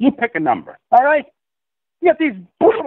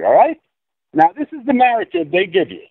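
usual speaking voice

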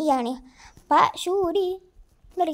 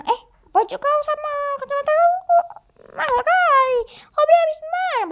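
high put-on voice; 9 semitones higher, 4 dB louder than usual.

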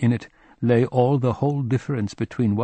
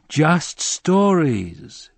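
slow, drawn-out and tired; weary; 8 semitones higher, 5 dB louder, much slower than usual. A narrator's reading. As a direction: sigh the words out in resignation. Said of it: "obviously tired of answering questions".

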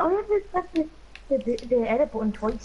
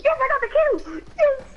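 high, loud victory whoop; excited cheer; 5 dB louder than usual. Real, not acted. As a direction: celebrate the kill at the top of your voice. Cheers after getting a kill.

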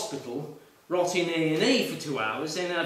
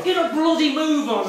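louder, higher exasperated thought; impatient thought voiced aloud; impatient inner grumble; 8 semitones higher, 7 dB louder than usual. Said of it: "I thought".

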